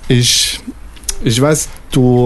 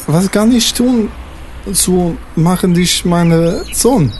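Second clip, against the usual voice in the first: put-on higher, pleading in broken French-accented German; 4 semitones higher than usual. French father-figure begging his lover's son, French accent pleading. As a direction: beg in a French accent, pitched up.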